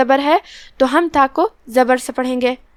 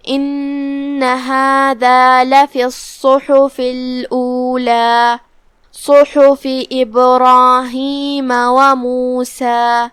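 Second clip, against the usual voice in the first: very slow tajweed chant, drawn-out vowels; slow melodic Quran recitation; Quranic recitation; much slower than usual. Shown as a demonstration. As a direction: recite the verse slowly and melodically. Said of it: "teacher recites the verses".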